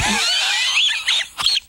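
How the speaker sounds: very high strained wheeze; wheezy wheeze. Retold or imitated. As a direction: wheeze out of breath. Wheezing old man sound.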